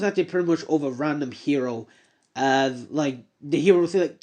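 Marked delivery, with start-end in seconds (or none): none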